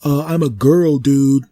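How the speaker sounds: put-on the tree telling the man she is a girl. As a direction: correct him, a bit indignant. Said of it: "and then the tree said".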